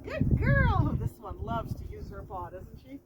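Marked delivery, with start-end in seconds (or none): 0.0-1.0 slow, high, sing-song praise for the dog; drawn-out praise. Real, not acted. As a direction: stretch out the praise to reward her.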